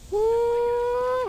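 high, gleeful whoop; excited woo-hoo. Real, not acted. Celebrating a kill with a high whoop. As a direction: cheer like you just won.

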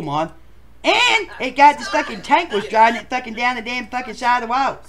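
ordinary talk, nothing marked

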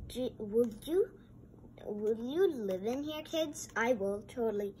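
ordinary delivery

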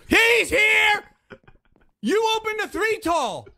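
high, loud alarm; alarmed cry. Real, not acted. Player warning friend, alarmed shout. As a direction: warn that the monster is here. Warden appears.

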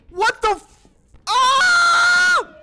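cut-off curse that breaks into a high shout; startled scream. Real, not acted. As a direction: yelp in real fright. Jump scare in horror game.

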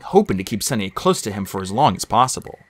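usual speaking voice

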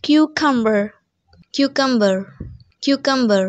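cucumber as KYOO-kum-ber; correct pronunciation. Shown as a demonstration. Teacher modelling the word for students, correctly pronounced. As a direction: say cucumber starting with a kyoo sound. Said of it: "the correct pronunciation is".

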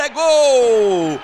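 roaring, high goal call. Real, not acted. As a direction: erupt into the goal shout. Goal scored, commentator erupts.

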